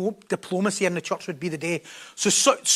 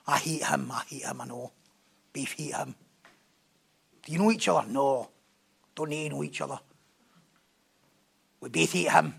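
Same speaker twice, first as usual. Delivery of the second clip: mock hateful conspirators; 8 semitones lower, 8 dB quieter than usual. Acted conspirators against Paul.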